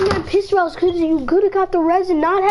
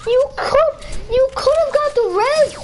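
whiny complaint; 10 semitones higher than usual. Real, not acted. Raging at Tanner for not reviving.

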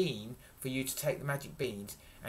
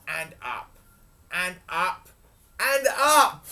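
rising to high and loud; building climax; 5 semitones higher, 11 dB louder, slower than usual. A narrator's reading. Storyteller taking Jack to the top, building. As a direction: climb in pitch and volume with each repeat.

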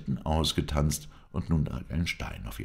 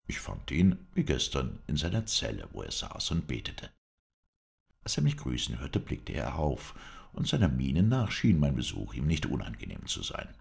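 plain reading voice

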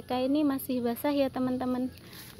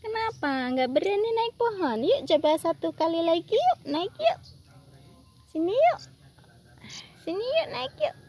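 higher sing-song coaxing with rising yuk; coaxing pet talk; 7 semitones higher, 5 dB louder than usual. Acted. Urging her squirrels to climb the tree, higher sing-song coax. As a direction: coax them up like a fond owner.

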